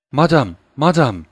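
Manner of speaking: loud call up the stairs. Acted. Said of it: "diye bağırdı". (üvey annesine merdivenden seslenen genç kız, yüksek sesle)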